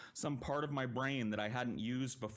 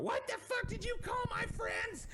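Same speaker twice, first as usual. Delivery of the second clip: very high, shrill screaming caricature; mock drunken scream. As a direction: screech like the furious drunk sister. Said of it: "she's screaming at the top".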